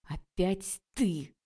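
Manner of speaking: slow, loud, seething man's voice; angry man's voice. A narrator's reading. Angry lecturer confronting the girl who blocked his car. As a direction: drag it out, barely holding the rage.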